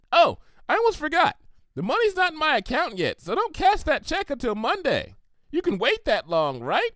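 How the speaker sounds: high, bubbly cartoon pony voice; Pinkie Pie voice. Pinkie offering Applejack a party, high and bubbly.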